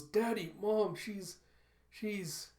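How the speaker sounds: put-on high, faltering child voice; distressed child. Daughter breaks news of her mother, faltering in distress.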